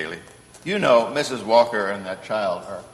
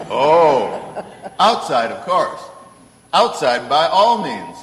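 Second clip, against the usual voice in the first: chuckling through the words, louder; amused, laughing it off; laughing answer; 4 semitones higher, 6 dB louder, slower than usual. Acted. Santa amused by the lawyer's whisker question, laughing.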